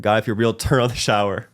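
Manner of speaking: hammed-up kid daring God to prove himself; childish plea to God. Childhood prayer in the shower.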